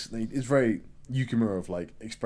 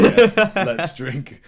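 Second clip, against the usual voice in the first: drawn-out, high and much louder yes, let's drink; passive aggressive; 7 semitones higher, 14 dB louder, much slower than usual. Retold or imitated. Mother to dad, passive aggressive and drawn out. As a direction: grit a sweet yes through her teeth. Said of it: "there's, like, passive aggressiveness".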